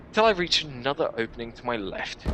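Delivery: plain reading voice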